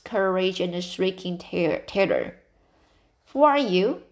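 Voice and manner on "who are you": higher, louder sharp challenge; stern old lady confronting a girl, curt and loud; curt demand. A narrator's reading. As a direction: snap at the intruder. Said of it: "demanded Miss Josephine Barry without ceremony".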